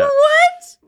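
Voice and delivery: high, loud shocked yelp; real disbelief, shot up high; startled what. Real, not acted. Player hears huge dice roll, real shocked yelp. Stunned by plus fifteen modifier.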